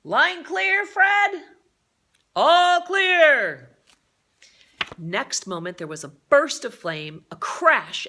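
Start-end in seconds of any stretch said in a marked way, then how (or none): 0.0-1.5 high, loud call over the wind. Acted. Coast Guard man checking the line with Fred, loud call. Rocket crew calling across the gale. 2.3-3.8 high, loud shouted reply; calling back. Acted. Fred answering the Coast Guard leader, loud shouted reply. Crew member answering over the gale.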